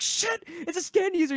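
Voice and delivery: high-pitched mock alarm; retold cry of alarm; freaking out. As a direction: yelp it like a panicked character. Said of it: "He freaks out".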